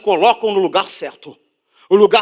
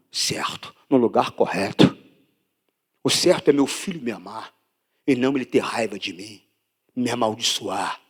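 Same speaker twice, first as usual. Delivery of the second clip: much lower and softer, near a whisper; hushed preaching; 11 semitones lower, 6 dB quieter than usual. Real, not acted. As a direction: draw them in with a hush. Pregador fala bem baixo. (pregação sussurrada e íntima)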